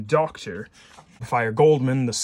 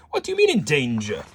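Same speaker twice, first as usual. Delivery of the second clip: put-on louder, startled Viennese-accented professor; 3 semitones higher, 6 dB louder than usual. Professor hearing George is in danger, Viennese accent. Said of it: "shall I do a Viennese as-, accent".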